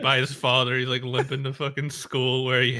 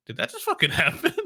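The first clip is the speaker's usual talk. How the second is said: higher, fast, laughing disbelief; stunned disbelief; 8 semitones higher, faster than usual. Retold or imitated. Imagining the stranger's shocked reaction, quick and laughing. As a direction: blurt it out quickly through a laugh. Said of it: "they would be like".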